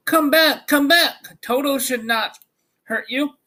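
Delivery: calling out. A narrator's reading. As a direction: call the scattered mice back. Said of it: "he called to the mice".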